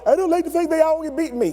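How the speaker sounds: put-on high whining child voice; whiny kid. Son whining to his father about always being beaten. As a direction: complain like a sore loser.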